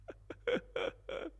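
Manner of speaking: high breathy wheeze of a laugh; airy high laugh. Real, not acted. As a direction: laugh silently through the nose. Laughs at his own bit.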